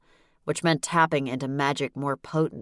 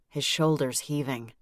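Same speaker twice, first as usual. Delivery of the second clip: plain reading voice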